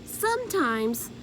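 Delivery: ordinary delivery, nothing marked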